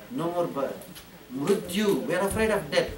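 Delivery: normal talking voice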